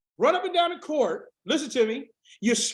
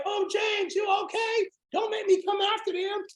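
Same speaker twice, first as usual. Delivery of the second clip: high, fussing friend on Facebook; a hint of a worried friend, voiced high; mock worried friend; 8 semitones higher than usual. Facebook friends fussing over James, high mock concern. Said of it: "they be like".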